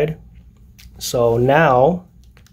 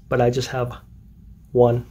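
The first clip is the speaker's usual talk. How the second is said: ordinary delivery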